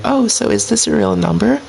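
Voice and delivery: high, put-on girl voice. Shown as a demonstration. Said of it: "So I switched my voice immediately".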